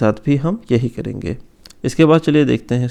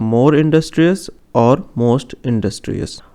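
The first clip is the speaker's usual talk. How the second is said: both forms said slowly and distinctly; slow careful demo; much slower than usual. Shown as a demonstration. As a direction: lay each form out slowly for students. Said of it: "teacher demonstrates degree forms". (धीमे, साफ़ शिक्षक वाले अंदाज़ में)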